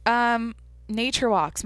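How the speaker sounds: slow, deliberate pace; consciously slowed-down speech. Real, not acted. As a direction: take it slowly on purpose. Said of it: "I'm trying to go slower".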